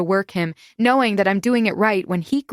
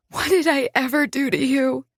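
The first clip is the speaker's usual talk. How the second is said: shaky voice; 6 semitones higher than usual. Acted. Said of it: "hating how shaky my voice sounds".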